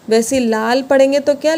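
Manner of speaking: ordinary talk, nothing marked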